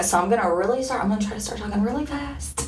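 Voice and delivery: fast, rushed words; talking fast. Retold or imitated. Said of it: "I was like".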